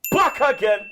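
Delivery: loud excited entrance call; hyped shout. Acted. Back on the show, hyping his own return, high and loud.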